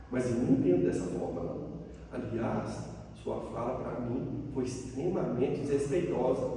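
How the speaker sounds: low, soft, measured recital; toneless written message. Retold or imitated. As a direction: read out a calm message quietly, keeping emotion out. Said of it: "porque não tem tom de voz". (mensagem lida em voz baixa, sem emoção)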